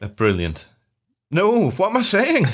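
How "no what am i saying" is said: laughing. Real, not acted. Laughing at own slip.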